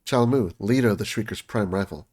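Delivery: ordinary talk, nothing marked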